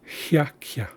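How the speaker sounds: Hiakia, ya sound after both consonants; Haisla word demo. Shown as a demonstration. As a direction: Hiakia said hya-kya, each glide clear. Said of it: "a ya sound after both consonants".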